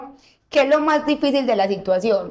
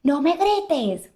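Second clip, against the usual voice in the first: daughter's protest; 5 semitones higher, much slower than usual. Retold or imitated. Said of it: "es como que".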